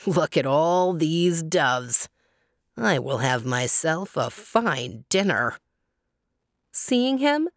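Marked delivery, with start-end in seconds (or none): 0.1-5.6 sly hunter eyeing his dinner; gloating hunter. A narrator's reading. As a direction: savour the catch like a villain. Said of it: "said the hunter".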